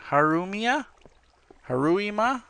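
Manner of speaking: "Harumia?" and "Haruima?" pitched high as questions; puzzled questioning. Real, not acted. Player puzzling over a viewer's question, "Harumia? Haruima?" as baffled high guesses. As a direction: try "Harumia?" then "Haruima?" as unsure guesses.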